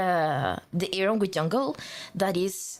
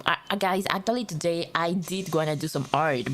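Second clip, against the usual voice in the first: usual speaking voice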